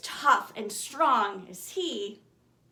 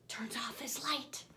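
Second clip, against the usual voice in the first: softer, breathy bedtime whisper; 9 dB quieter than usual. A narrator's reading. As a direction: let the line fade to a whisper. Lights out, bedtime.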